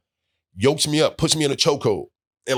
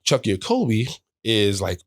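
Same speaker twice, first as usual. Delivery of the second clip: usual speaking voice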